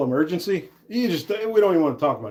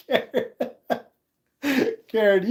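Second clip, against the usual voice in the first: much higher, laughing call of "Karen"; playful teasing; 8 semitones higher than usual. Real, not acted. Laughing at chat viewer Karen's comment, teasing high call. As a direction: call her name up high through a giggle.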